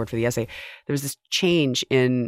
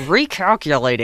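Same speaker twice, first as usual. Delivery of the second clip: higher, emphatic sat-nav scolding; exasperated GPS; 4 semitones higher, 4 dB louder than usual. Retold or imitated. GPS when you miss a turn, mock exasperation. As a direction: imitate a judgmental GPS on the one word. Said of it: "it sounds like they're saying".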